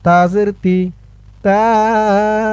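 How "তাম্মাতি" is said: তাম্মা drawn out very slowly; slow recitation. Shown as a demonstration. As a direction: chant তাম্মা long. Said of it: "ভেঙে ভেঙে বলবো". (ধীরে টেনে তাম্মা)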